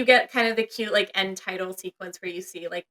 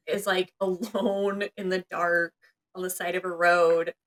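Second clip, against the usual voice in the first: normal talking voice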